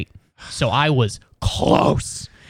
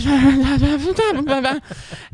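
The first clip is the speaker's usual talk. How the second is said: high-pitched burst of laughter; 10 semitones higher than usual. Real, not acted. Laugh after retold apology.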